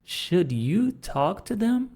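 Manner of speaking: "Should you talk to them?" with a big rise on "them"; high question rise. Shown as a demonstration. Teacher exaggerates question tune for students. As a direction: ask it, voice climbing high at the end. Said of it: "Joga o should lá na frente".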